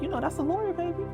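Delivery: put-on mom gently explaining to her kid; mother to child. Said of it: "she said".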